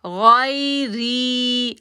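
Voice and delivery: "गैरी" slow, thick "गै" and stretched "री"; heavy ghain, long ree. Shown as a demonstration. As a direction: make "गै" heavy and hold "री". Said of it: "मोटा करेंगे और री को खींचेंगे". ("गै" मोटा, "री" लंबा)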